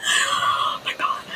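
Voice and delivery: wheezing, voiceless laugh through the words; breathless, laughed-out whisper; helpless laughter. Real, not acted. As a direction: gasp it out while laughing too hard. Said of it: "I can't stop laughing".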